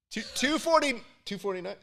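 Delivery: high, halting, held-back call; hesitant price call. Retold or imitated. Contestant echoing the crowd's shouted price, high and unsure. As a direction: stammer out a guess in a high voice. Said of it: "I was doing this where I was like".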